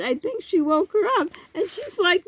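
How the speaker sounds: ordinary talk, nothing marked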